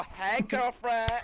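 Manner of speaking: high, loud, drawn-out camp greeting. Acted. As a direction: ham up a sassy camp voice, slowly. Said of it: "put our hand on our hips".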